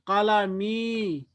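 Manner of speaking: कलमी stretched very slowly, each sound held; very slow demo. Shown as a demonstration. Teacher slowly modelling the word for students to repeat. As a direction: draw कलमी out for them to copy. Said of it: "आप एक लड़की का कलम".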